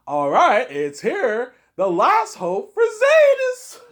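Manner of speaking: very high, shouted, stretched-out dramatic announcement; Rabbit impression. YouTuber mimics walkthrough star introducing the game, hammed-up shouting impression. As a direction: build to a huge, drawn-out hype yell. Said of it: "it's not bad impression of him".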